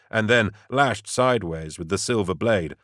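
usual speaking voice

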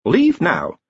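precise, measured crystal ball; calm machine voice. A narrator's reading. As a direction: speak like a polite oracle. Hex commands.

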